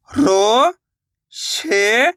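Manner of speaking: रो, शे, each stretched with a rising end; syllable-by-syllable sounding out. Shown as a demonstration. Teacher spells out a word for beginners, रो and शे drawn out singly. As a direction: hold रो and शे apart, lifting each. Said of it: "एक एक अक्षर का उच्चारण करके".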